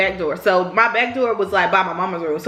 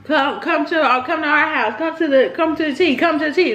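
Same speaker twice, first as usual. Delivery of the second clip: higher, insistent mimicked taunt; mock challenge; 6 semitones higher than usual. Cousins urging her to come fight, mock challenge. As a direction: pester them to come over, voice pitched up.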